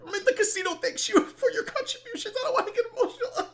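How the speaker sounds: high squeaky fake crying through laughter; hammed-up weepy thanks, cracking up; mock tearful gratitude. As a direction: pretend to be overcome, laughing. Mimics a man's tearful thanks.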